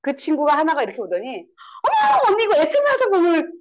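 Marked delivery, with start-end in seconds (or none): 1.8-3.5 high, loud amazed question; imitated surprise. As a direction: act out a neighbour's astonished discovery. Imitating neighbour's surprised discovery.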